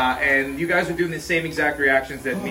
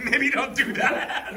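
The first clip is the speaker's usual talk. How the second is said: laughing; 9 semitones higher, much slower than usual. Real, not acted. Friend gargling milk after spicy food.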